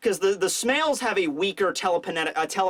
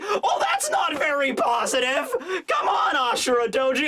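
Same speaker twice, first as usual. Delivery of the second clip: mock exasperation; 7 semitones higher than usual. Reader objecting to Ashura Doji's gloom, exasperated.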